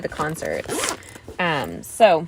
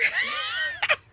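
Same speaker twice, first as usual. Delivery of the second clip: mock sob; 5 dB quieter than usual. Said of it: "she keeps crying".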